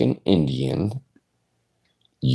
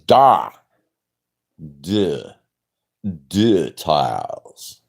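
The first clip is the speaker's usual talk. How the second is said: lightly put-on French accent, de for the; a hint of a French accent; mock French accent; 5 semitones lower, much slower than usual. Joking that the tires are French, mild French accent. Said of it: "The tires is French".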